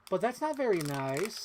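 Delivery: high, slow singsong reproach starting on "But"; full catchphrase. Shown as a demonstration. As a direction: draw out the quote, lifting the end. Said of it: "you have to say the full phrase".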